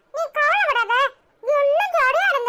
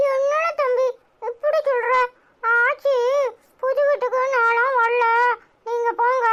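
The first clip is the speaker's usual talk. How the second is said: ordinary delivery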